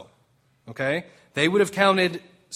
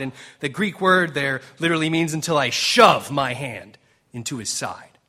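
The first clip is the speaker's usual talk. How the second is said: normal talking voice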